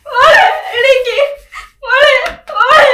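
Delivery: loud, high sobbing shout; wailing cry. Acted. Mother finding her dead daughter, wailing loudly. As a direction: scream for her to wake up.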